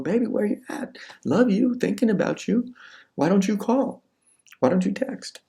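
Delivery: high, needy put-on girlfriend voice; pleading girlfriend. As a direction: imitate a girlfriend begging for attention. Acted girlfriend pleading for reply.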